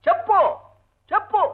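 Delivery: insistent demand. Acted. Husband presses wife to say why she came, insistent. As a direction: press her for an answer.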